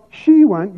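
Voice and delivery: "she" hit high and loud; emphatic stress on SHE. Shown as a demonstration. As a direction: lean hard on "she", lighter on "went".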